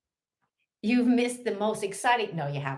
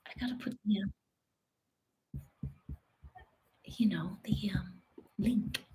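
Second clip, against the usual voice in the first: whisper; 10 dB quieter, faster than usual. Real, not acted.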